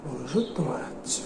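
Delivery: very soft, low grumble; contemptuous aside. Acted. Boss grumbles about Bablu's moralising, quietly contemptuous. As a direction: drop it under your breath with scorn.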